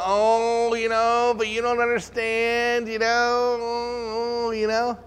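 a hint of fake-humble hand-wringing; false humility. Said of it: "look at it as false humility".

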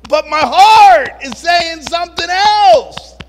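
very loud, high, slow anguished cry. Retold or imitated. Hurting people crying out against pushy friends, anguished. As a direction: cry out from the heart.

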